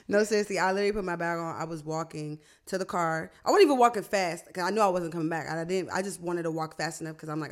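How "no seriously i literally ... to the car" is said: ordinary talk, nothing marked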